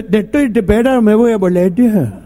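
"desesperado" said as "tepetar", s turned to t; lisping speech defect. Retold or imitated. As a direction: imitate Miguelito's s-to-t defect. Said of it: "tenía un problema de dicción".

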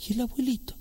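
put-on high, soft child question; a little boy asking about grandpa. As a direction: ask it like an innocent little kid. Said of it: "el pendejo y te dice".

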